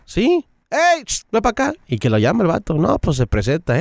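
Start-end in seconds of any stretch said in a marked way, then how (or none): 0.1-1.7 boss's reply. Retold or imitated. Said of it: "le pregunté a él".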